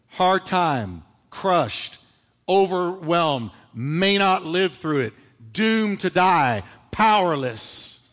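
each word slow and weighted; slow emphatic list. Acted. Preacher slowly listing Paul's hardships for the church.

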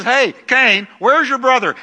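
high, loud call to Cain; God calling out. Retold or imitated. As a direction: call out across the field like God seeking Cain. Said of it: "He says".

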